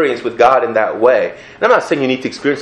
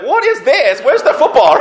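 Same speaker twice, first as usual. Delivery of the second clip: put-on baffled kid. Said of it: "I'm like".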